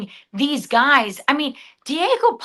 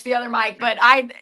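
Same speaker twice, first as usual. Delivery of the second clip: usual speaking voice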